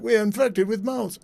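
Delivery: high-pitched mocking quote; mocking mimicry. Host quotes politician's claim of moles, mocking mimicry.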